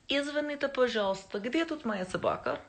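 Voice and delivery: Russian phrase again. Shown as a demonstration. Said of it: "Anybody know what that means".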